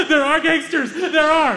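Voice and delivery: gasp, then high, loud terrified cry; panic-stricken and out of breath; panicked gasp. Retold or imitated. Frightened visitor in the back seat, panicked and gasping. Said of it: "Hamza is hyperventilating".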